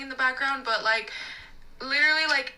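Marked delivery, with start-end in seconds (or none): none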